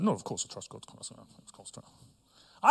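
hushed, unvoiced mock protest, fading away; defensive denial. Preacher mimics a listener's hushed, defensive denial. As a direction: protest your faith half to yourself, softly. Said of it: "and I was like".

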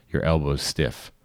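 ordinary delivery

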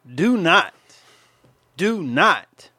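"do not" as two heavy, separated commands; emphatic warning. Real, not acted. Warning gentlemen against sending pics, emphatic.

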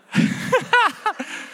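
very high giggling burst; real laughter. Real, not acted. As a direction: break into helpless high laughter. Cracks himself up mid-story.

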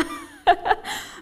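high, loud burst of laughter; bright peal of real laughter; amused laugh. Real, not acted. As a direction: laugh out loud at your own story. Laughs about scaring the neighbours.

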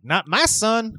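put-on outraged mother with a touch of southern accent; high Southern-tinged protest. Mother-in-law overhearing the affair, hint of southern accent. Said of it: "she grabbed a southern accent out of nowhere".

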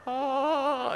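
high, startled mock gasp. Retelling the restaurant scene, a high mock gasp. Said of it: "They said".

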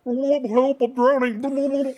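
loud, slow mock cry for help; drowning. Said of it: "ask me if I'm all right in here".

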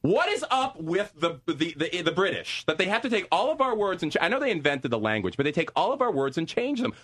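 high, worked-up rant about British words. Real, not acted.

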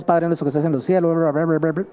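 fast, mechanical rattled-off prayer; rote prayer. Retold or imitated. Preacher mocking careless prayer, rattled off fast. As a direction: rush through it like a habit. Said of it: "Tiene mucho que ver la actitud".

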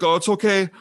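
high, airy brush-off imitation; mock his breezy reassurance, way up high; mimicking Sean. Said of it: "and he's like".

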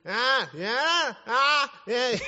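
put-on high, loud toddler squawks; playful baby-girl impression; imitating baby talk. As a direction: squeal like a one-year-old trying to talk. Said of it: "That's how she talks".

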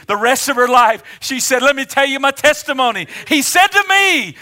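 loud, high, driving sermon climax; impassioned shouted preaching; full-force preaching. Acted. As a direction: build and proclaim it to the whole congregation.

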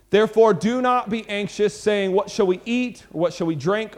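measured, deliberate reading of scripture. Acted. Preacher reads scripture aloud to the youth group.

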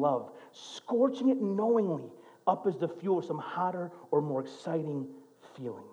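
hushed, reflective quotation; quiet reading. Retold or imitated. Pastor reading a book quote to the congregation, softly. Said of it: "He says this".

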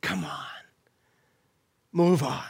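low breathy whisper of invitation; whispered coaxing. Acted. Preacher whispering Jesus' call to the congregation, hushed. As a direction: breathe it as a tender urging. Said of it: "is he speaking that to you and me today?".

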